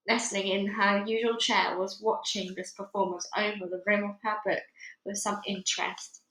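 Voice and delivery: ordinary delivery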